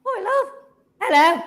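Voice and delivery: put-on light, high Aussie accent as a female kangaroo; Australian kangaroo voice. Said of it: "I've gotta change my voice".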